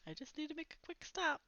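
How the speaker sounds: unhurried dad brushing off the worry; easygoing dad. A narrator's reading. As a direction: toss it off like a carefree father. Dad answering son in van.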